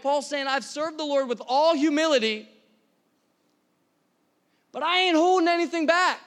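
fervent pulpit declaration; full-force preaching. Real, not acted. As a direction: preach it out loud and high, driving every phrase.

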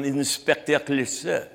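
hammed-up French accent; mock-French cartoon detective pronunciation. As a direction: say the name in a silly French accent. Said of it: "my French accent came from".

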